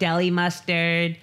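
put-on high, drawn-out Valley girl; mock wasted-girl voice. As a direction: order slowly as a tipsy customer.

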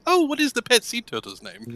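high, quick, very proper voice; super proper. Acted. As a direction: inquire like a dainty gentleman. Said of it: "super proper in Aquan".

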